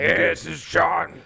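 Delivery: raspy smoker's voice, slow; gravelly call-in voice like a chain smoker; smoker's rasp. Pretend. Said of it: "a smoker's voice for the call-in".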